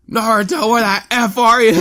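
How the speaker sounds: high, loud calling out; imitated shrill plea; mock distressed girl. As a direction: holler for him in mock desperation. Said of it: "And she just goes".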